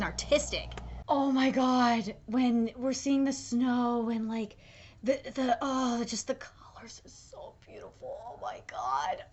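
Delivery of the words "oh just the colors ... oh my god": awed whisper. Real, not acted. Awed by anime colours.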